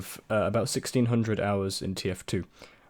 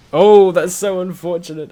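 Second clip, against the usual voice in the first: higher and louder dismayed cry; 9 semitones higher, 13 dB louder than usual. Real, not acted. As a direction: groan at the bad luck. Reacting to bad gameplay moment.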